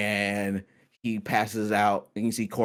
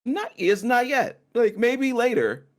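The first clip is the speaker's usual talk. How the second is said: higher NPC voice; 11 semitones higher than usual. Acted. GM voicing Corwin, higher.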